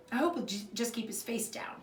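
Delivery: ordinary delivery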